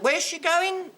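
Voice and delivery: high mock query; puzzled high voice. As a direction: ask like a bemused lady. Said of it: "I said".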